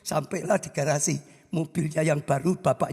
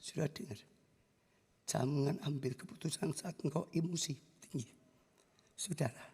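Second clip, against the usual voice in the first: solemn hush; 2 semitones lower, 11 dB quieter than usual. Real, not acted. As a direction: let it sink in quietly. Pengkhotbah merenung pelan. (pengkhotbah berbisik sungguh-sungguh)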